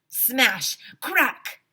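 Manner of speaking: loud, high punchy smash and crack; loud cartoon crash sound effects. A narrator's reading. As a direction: bang out the crash like a comic. Smashing the smoke detector.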